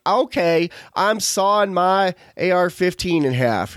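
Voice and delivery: mock a southern gun owner, drawn out; southern accent. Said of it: "I'm doing a southern accent".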